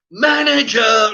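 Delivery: high, loud yell; screaming for the manager. Retold or imitated. Customer yelling it over and over, screaming. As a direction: bellow it as a repeated demand. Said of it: "Like this, five, six, seven times".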